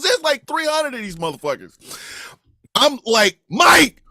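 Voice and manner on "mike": high, loud shout for a friend; acted yell for a lost buddy; calling out. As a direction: holler the name across a crowd.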